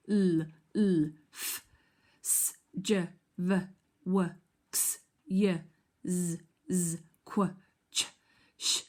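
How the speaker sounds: crisp phonics sounds, one by one; letter sounds. Shown as a demonstration. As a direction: clip each letter sound out for the class. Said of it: "say them and do the action".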